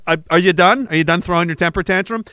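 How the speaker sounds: ordinary talk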